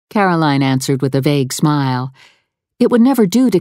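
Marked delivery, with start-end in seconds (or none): none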